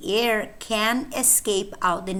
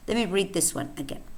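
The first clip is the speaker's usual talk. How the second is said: ordinary talk, nothing marked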